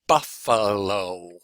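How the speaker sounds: buffalo drawn out slowly as buf-fa-lo; a slow, measured buf-fa-lo for the class; syllable by syllable. Shown as a demonstration. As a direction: stretch each syllable of buffalo. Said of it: "let's do one with syllable".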